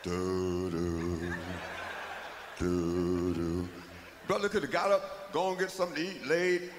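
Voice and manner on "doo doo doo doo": low, slow plodding doos; monster footsteps. Retold or imitated. The monster creeps toward the fallen victim, low doos. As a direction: hum it deep like a slow monster.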